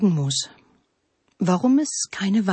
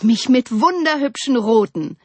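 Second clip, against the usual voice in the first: higher, louder proud call; 5 semitones higher, 5 dB louder than usual. A narrator's reading. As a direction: call out as the delighted cherry tree. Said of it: "rief der Kirschbaum". (hell und stolz rufend)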